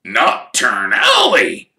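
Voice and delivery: slow grim Hagrid growl; grimly. Acted. Hagrid telling the Weasleys where Harry was, slow and grim. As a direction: say the name darkly. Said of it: "said Hagrid grimly".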